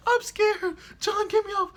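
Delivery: high falsetto squeak, pleading; mock scared girl. T J on the ride, pleading in a high mock-scared voice. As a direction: play the frightened friend begging. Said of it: "She like".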